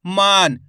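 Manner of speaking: मान with a long, loud आ; teacher leaning on the long vowel of मान; drawn-out vowel. Shown as a demonstration. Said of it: "माँ का उच्चारण खींच को हो रहा".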